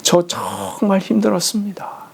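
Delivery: breathless whisper, leaning on 저; whispered monologue. Retold or imitated. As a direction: repeat 저 정말 힘들었습니다 in a whisper with the weight on 저. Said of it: "독백하시지".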